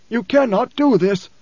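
high, slow, emphatic preacher's voice; the preacher, protesting. Acted. Preacher begins again to stop Leto, high and insistent. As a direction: forbid it, pitched far above narration.

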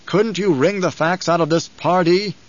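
a lord angrily questioning his aide; angry. A narrator's reading. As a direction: pitch it up in contained anger. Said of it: "he felt anger consuming him".

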